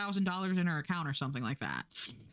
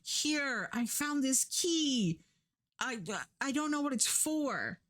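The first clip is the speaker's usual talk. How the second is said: higher, slow, hesitant helper; higher halting mock innocence; timid girl; 7 semitones higher, slower than usual. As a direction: offer it up shyly. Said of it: "she's like".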